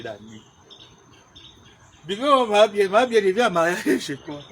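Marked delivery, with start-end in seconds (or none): 2.1-4.4 loud protest. Acted. Arguing back at a comment.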